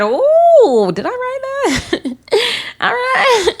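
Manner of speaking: high squealing laugh, stunned; laughing shock. Real, not acted. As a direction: gasp then laugh in disbelief.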